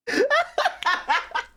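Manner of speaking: very high burst of laughter; high cackling laugh. Real, not acted. Laughing at her own mix-up, high cackle. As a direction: cackle at the memory. Cracking up at the story.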